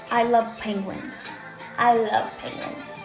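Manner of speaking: ordinary talk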